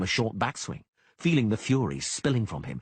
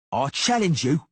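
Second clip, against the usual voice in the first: higher, louder insisting; repeated challenge; 8 semitones higher, 6 dB louder than usual. A narrator's reading. Cicada insisting to Geralt, higher and louder. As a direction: insist once more. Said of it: "Cicada repeated".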